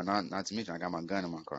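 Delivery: normal talking voice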